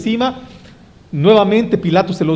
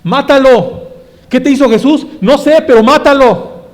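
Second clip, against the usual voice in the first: furious, loud, high mob yell; enraged mob shout; 6 semitones higher, 7 dB louder than usual. Retold or imitated. The people of Israel screaming for Jesus' death, furious shout. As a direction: scream it with hateful rage. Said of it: "gritando aún más enfurecido que eso".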